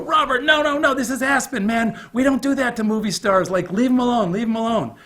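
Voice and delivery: high frantic "no, no" from the ski-bum friends; friends pleading. Retold or imitated. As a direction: friends begging him not to bother the star. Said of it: "And they go".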